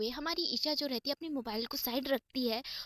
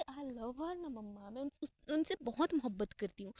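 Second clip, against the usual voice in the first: tender confession; 3 dB quieter than usual. Acted. Daughter tells her mother she loves Ira, tender and soft. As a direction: draw it out gently, much softer.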